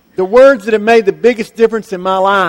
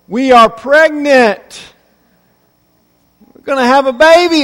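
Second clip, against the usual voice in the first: excited announcement; 4 semitones higher, 4 dB louder than usual. Retold or imitated. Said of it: "she comes into you and she says".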